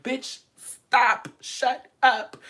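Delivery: retold exasperated outburst. Exasperated reply to a rambling drunk, loud mock scolding.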